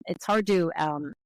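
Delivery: ordinary talk, nothing marked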